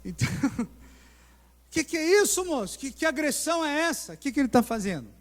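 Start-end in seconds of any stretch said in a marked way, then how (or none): 1.7-5.1 mais agudo e alto, indignado; espanto indignado. Retold or imitated. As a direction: reage chocado à agressão. Reação indignada à agressão. (testemunha chocada, voz alta e aguda)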